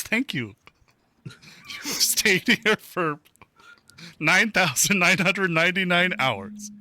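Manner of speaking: high-pitched robotic ticket machine voice; piercing robot. Acted. Ticket booth thanks a departing driver, piercing robotic voice. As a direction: read it out like an automated pay booth, high and piercing. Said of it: "It's that piercing robotic voice".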